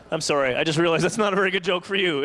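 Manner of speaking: ordinary delivery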